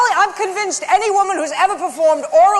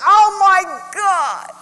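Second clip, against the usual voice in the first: higher, louder mock sob of a wailing mother; mock crying mother; 8 semitones higher, 4 dB louder, slower than usual. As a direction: wail it like a heartbroken mother, half-hammed. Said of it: "She was like".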